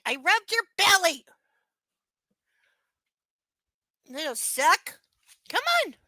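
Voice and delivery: high, sing-song coaxing voice; coaxing pet talk. Real, not acted. Owner coaxes her puppy up, high cooing. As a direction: lure the dog over sweetly.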